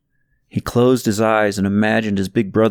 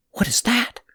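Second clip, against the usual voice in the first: much higher, softer, hushed question; awed wonder; 12 semitones higher, 4 dB quieter than usual. Acted. A boy seeing strange lights, hushed and high. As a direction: gasp in wonder.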